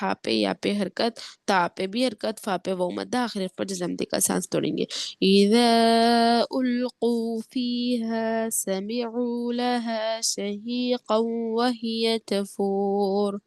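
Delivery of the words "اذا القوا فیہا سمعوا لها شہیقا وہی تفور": very slow, drawn-out tajweed chant; Quran recitation. Shown as a demonstration. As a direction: stretch each word in a melodic recitation. Melodic recitation of the verse.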